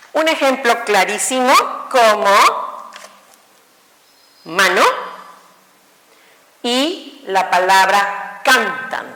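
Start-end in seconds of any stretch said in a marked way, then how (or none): 4.4-4.8 mano with the first syllable "ma" stressed. Shown as a demonstration. Teacher modelling the stress of mano for students. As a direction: lean on "ma" in mano. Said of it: "dónde sonó más? En ma".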